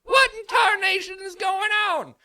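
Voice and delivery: very high, loud yokel shout; old hillbilly shout. Acted. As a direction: holler it like a startled old farmer. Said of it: "you hear from the back somewhere".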